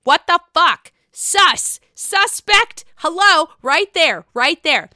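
loud, high indignant outburst; outraged. Real, not acted. Vlogger reacting to Raquel's lie, loud and outraged. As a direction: call it out, loud and scandalized.

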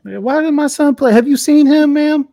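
high-pitched mock parent voice; complaining sports parent. Coach mimicking a parent asking about playing time, high mock complaint. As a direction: push it up high, indignant questioning.

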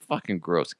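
soft, low grossed-out mutter; disgusted mutter. Real, not acted. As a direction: mutter it under your breath.

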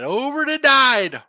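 drawn-out excited yell; excited shout. Real, not acted. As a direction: stretch it out, hyped. Excited call on a pick.

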